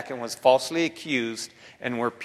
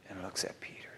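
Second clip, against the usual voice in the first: whispered dramatic climax; hushed narration; 7 semitones lower, 18 dB quieter, faster than usual. A narrator's reading.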